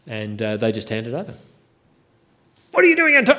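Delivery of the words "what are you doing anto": high, loud mock-indignant owner; donkey owner's high, loud protest. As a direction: squawk it like a startled donkey owner. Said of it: "The lords of the donkey will say".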